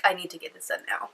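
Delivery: normal talking voice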